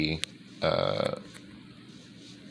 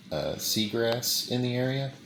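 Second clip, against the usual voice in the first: usual speaking voice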